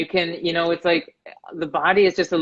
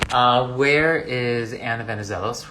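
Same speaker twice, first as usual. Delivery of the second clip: normal talking voice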